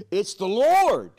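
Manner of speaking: very loud, high, drawn-out cry; slow, ringing holler of recognition; exclaiming shout. Acted. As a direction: shout the discovery to the whole boat. Disciple cries out recognizing Jesus.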